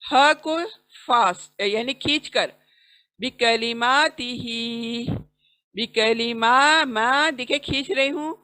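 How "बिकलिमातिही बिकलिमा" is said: बिकलिमातिही with माँ and ही long, the rest quick; slow tajweed reading of बिकलिमातिही; drawn-out recitation. Shown as a demonstration. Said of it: "माँ को खींचना है".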